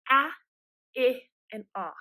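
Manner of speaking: "Ah" as in "hat", "I" as "ih", "ah" as in "hot"; short vowel sounds. Shown as a demonstration. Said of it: "gonna say its short sound".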